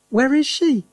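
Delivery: high, loud frantic question; wife crying out for her lost mother. Acted. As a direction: call out in panic. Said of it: "cried Missus Cranky".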